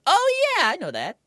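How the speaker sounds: playful falsetto outburst; mock squeal. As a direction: shoot the voice way up in a squeal. Sudden high-pitched mock exclamation.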